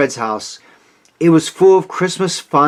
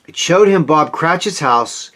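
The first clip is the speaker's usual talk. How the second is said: plain reading voice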